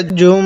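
"জুম" with a long hummed m; ghunna. Shown as a demonstration. Teacher showing learners ghunna, long nasal hum on "জুম". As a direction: hold the final "ম" of "জুম" through the nose. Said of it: "এখানে গুণনা করব".

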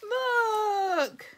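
high, loud and drawn out; delighted squeal. Real, not acted. Vlogger spotting the next gift item, squealing. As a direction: let it burst out in wonder.

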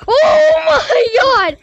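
slow, high, panicked scream; scared scream. Real, not acted. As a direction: scream in real fright. Ghost appears, screaming.

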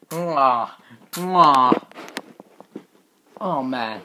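ordinary talk, nothing marked

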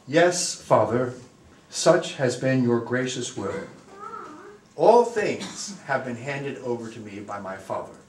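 measured Gospel reading with long pauses; formal, unhurried liturgical reading; solemn scripture reading. Acted. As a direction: read it out reverently to a congregation. Reads the Gospel aloud.

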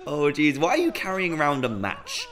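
usual speaking voice

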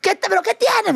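high-pitched imitated panic; alarmed friend. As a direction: play the friend demanding what is wrong. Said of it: "Y mi amiga".

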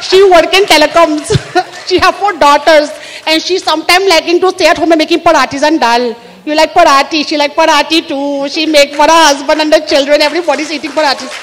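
put-on Indian accent, singsong; proud Indian homemaker listing her family; cheerful Indian English with retroflex consonants; Mrs Patel. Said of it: "Missus Patel is domestic goddess".

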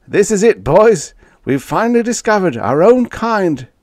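high, loud jubilant announcement; triumphant excitement. Acted. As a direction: proclaim the great find to the crew. Spaceman triumphant at the discovery.

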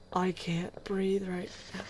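slow breathless whisper. Real, not acted. Gamer hiding from creatures, whispering in panic. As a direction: whisper it, barely able to breathe.

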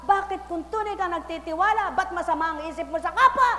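loud, high fiery preaching; preaching at full force. Acted. Preacher calling out to the congregation, fiery and loud. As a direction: call it out to the crowd.

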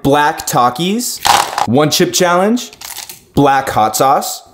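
ordinary delivery

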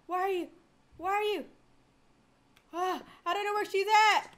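high, mounting alarm; frantic searching. Real, not acted. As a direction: call out anxiously for someone hidden. Searching frantically for game character.

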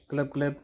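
bubbly glub; fish glub. Shown as a demonstration. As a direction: blub like a fish. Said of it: "fish sound".

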